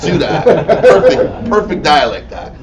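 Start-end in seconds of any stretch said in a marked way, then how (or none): 2.3-2.5 louder, very slow, laughing prosper said "prospa"; hammed-up Boston "prospa" with no R; laughing Boston accent mimicry; East Coast accent imitation. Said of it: "They don't even put the R in there".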